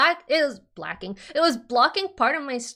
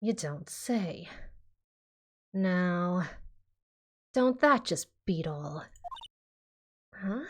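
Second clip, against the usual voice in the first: lower, slow Texas drawl; a lightly done, lower Texas drawl; Texas cowboy drawl; 3 semitones lower than usual. Acted. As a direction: spin a yarn like a Texas cowboy. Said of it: "it's all Texas over here".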